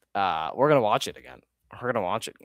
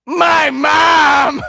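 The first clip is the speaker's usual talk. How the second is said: high loud shout; 13 dB louder than usual. Real, not acted. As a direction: yell it out in shock. Shouted reaction to clip.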